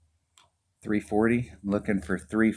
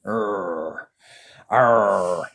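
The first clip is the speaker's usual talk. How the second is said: pirate growl; 4 dB louder than usual. Real, not acted. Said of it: "wish I could cuss like a sailor".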